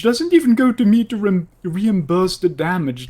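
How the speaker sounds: high, loud outrage; indignant. Acted. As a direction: protest the unfairness. Indignant complaint about fine.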